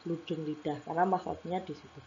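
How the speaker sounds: usual speaking voice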